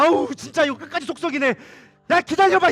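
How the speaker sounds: loud, high-pitched mock exasperation; loudly fed up, playfully acted; exasperated grumble. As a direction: act fed up and call out over the mess. Acted exasperated Jesus at severed ear.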